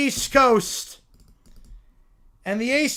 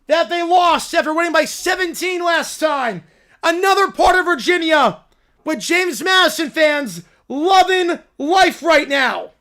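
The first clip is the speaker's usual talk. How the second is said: excited play-by-play; 4 semitones higher, 5 dB louder than usual. Real, not acted. Live call, big finish.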